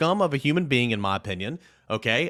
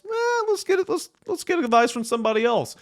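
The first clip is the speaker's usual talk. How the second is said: silly high voice; 12 semitones higher than usual. Pretend. Jokey high put-on voice.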